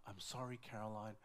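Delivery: low, soft, sorrowful apology; subdued, acted-out sadness; retold tearful confession. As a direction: break bad news to a wife, downcast. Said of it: "I remember just crying like a baby".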